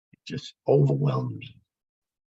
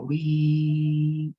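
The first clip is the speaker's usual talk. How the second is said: one held steady monitor tone; flatline tone; 2 semitones higher than usual. Retold or imitated. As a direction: imitate the flatline alarm. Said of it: "you hear that thing go".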